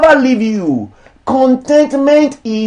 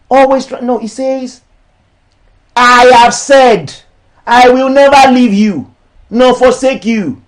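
raised, declamatory sermon voice; preaching; 4 semitones higher, 4 dB louder than usual. Acted. Pastor preaching against charging for ministry, declamatory. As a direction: preach it to the church, each line landing.

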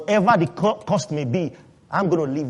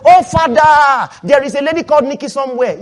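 mock earnest prayer; 7 semitones higher, 8 dB louder than usual. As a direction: ham up a desperate prayer for a wife.

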